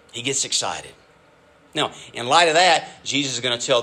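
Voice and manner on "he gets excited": low and soft, almost to himself; quiet. Acted. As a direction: let it fall away low and hushed. Preacher repeating line, dropped low.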